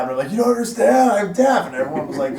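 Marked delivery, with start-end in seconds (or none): 0.3-1.7 high, louder pleading wail; hammed-up drunken distress. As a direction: protest in mock panic. Drunken protest, acted out.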